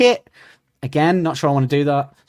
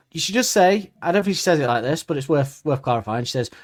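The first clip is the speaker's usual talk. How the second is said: ordinary talk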